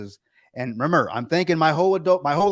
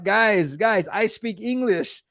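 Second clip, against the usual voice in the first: higher, slowed-down imitation of the agent; pointed interruption; 7 semitones higher, much slower than usual. Phone agent interrupting father and son's argument, pointed. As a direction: cut in slowly and pointedly, pitched up.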